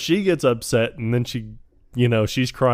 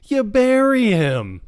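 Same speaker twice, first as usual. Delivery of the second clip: high, slow exasperated colonel; slow high mock exasperation; colonel quote; 12 semitones higher, 4 dB louder, slower than usual. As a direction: spell it out like it's obvious, pitched up. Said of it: "And he was like".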